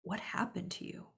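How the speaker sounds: whispered "What happened to you?"; gentle question. Shown as a demonstration. To someone hurting, a soft whisper. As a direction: breathe the question softly, no voice. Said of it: "you can change that question to".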